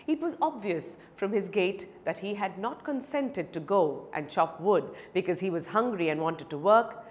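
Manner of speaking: usual speaking voice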